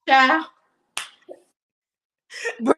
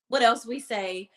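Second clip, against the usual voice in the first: ordinary delivery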